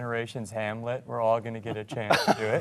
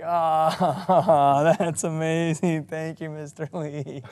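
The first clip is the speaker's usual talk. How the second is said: long, laughing gush, higher and louder at first; retold starstruck thanks; 5 semitones higher, 7 dB louder, much slower than usual. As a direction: gush like an awed fan, acting it out. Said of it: "And I'm like".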